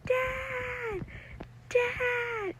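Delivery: high, desperate scream for Dad. Retold or imitated. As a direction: call out for Dad in panic. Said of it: "And I'm screaming".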